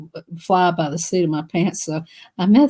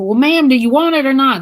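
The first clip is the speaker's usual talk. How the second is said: agitated clerk; 5 semitones higher, 6 dB louder than usual. Retold or imitated.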